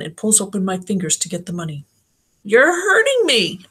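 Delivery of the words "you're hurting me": high, slow cry of pain; child's pained yell. A narrator's reading. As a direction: yell it out as she hurts you. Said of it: "I yell".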